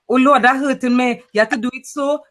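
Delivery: lilting Trini twang; Trinidadian twang. Acted. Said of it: "that's the Trini twang".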